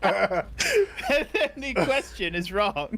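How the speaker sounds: slow, laughing retake; laughing slowly. Real, not acted. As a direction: laugh through the correction.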